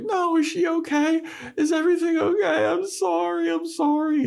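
very high, squeaky pleading; hammed-up falsetto worry and apology; mock frantic Willow. Willow begging Buffy for news of Dawn, squeaky mock pleading.

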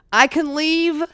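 incredulous. Retold or imitated. Trooper waves her on, loud incredulous disbelief. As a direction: cry it out in amazed relief.

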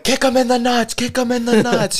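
high, loud mock shouting; imitated frantic yell. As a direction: call it out high like an urgent chant. Acted cry during the scuffle.

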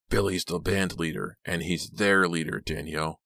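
ordinary delivery

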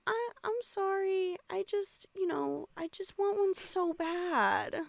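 high, teary mock sob; fake crying. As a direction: whimper it like a crying kid. Sam voiced crying in recap.

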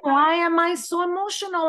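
high, pushed, baffled cry of why am I; mock exasperated asking herself; bewildered self-question. Retold morning crying for no reason.

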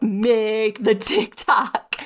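very slow, drawn-out inner urging; gut voice. Retold or imitated. Her gut urging her to post, very slow and insistent. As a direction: let the gut speak slowly, insistently. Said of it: "And my gut was like".

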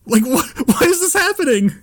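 high-pitched, flustered protest; bewildered panic. Retold or imitated. Baffled bystander denying it to the mother, high flustered voice. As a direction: stammer upward in mock distress. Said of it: "I'm so fucking confused".

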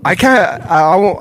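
ordinary talk, nothing marked

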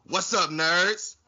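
loud, rising hype call; hyped greeting. Real, not acted. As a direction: pump up the crowd.

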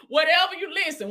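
ordinary delivery

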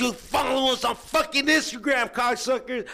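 high, slow hammed-up wrestler promo; wrestling promo. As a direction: cut a wild wrestling promo, slobbering. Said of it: "sign out with our best wrestling impression".

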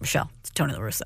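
Tony La Russa impression. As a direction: drop the voice and rattle off the name like a stern caller. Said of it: "just the tone in his voice".

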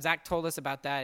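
ordinary talk

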